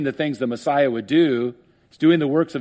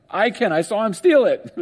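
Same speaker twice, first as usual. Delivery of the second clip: normal talking voice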